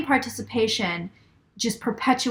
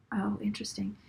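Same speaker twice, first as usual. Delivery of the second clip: whisper; 9 dB quieter than usual. Real, not acted. Aside while receiving a message.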